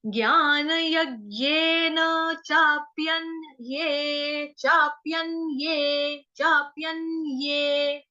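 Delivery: चाप्यन ये with a distinct य sound, slow; slow clear ye. Shown as a demonstration. Teacher shows the clear ये ending slowly. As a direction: separate ये clearly, not ए. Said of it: "उच्चारण स्पष्ट रूप से करेंगे".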